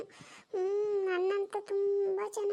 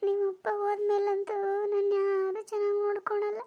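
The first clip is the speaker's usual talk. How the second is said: normal talking voice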